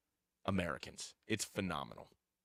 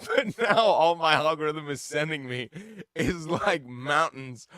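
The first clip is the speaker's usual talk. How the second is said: laughing; 6 semitones higher, 7 dB louder, slower than usual. Real, not acted. Laughing at his own story.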